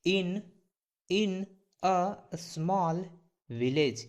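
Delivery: each word drawn out slowly; slow model reading. Shown as a demonstration. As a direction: spell it out in sound for learners. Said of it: "कैसे pronunciate करेंगे sir". (धीरे-धीरे उच्चारण करके दिखाना)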